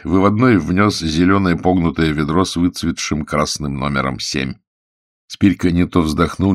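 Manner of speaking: ordinary delivery, nothing marked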